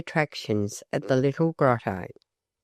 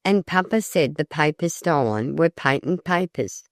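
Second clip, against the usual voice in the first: usual speaking voice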